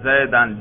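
Zaid ending in -an, "زیدن" as zay-dan; accusative ending. Shown as a demonstration. Teacher shows students the nasb ending, "زیدن" said zay-dan. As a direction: pronounce "زیدن" with an open final -an. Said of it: "نصب پہ تلفظ کر کے دکھاؤ".

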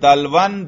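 دلون said as "dalwan"; accusative ending. Shown as a demonstration. Teacher showing three audible case endings, accusative second. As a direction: end دلون in a clear "-an". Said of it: "تینوں طرح کے اعراب لفظی آتے تھے".

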